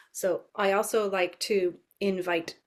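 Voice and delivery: ordinary talk, nothing marked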